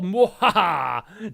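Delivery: slow evil villain laugh; mad scientist cackle. Pretend. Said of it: "Just kidding".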